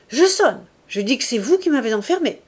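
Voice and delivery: high, loud threat that then rushes on; crying out. A narrator's reading. Woman threatening to ring for help, crying out. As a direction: call out a warning, then hurry the rest. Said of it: "Elle cria".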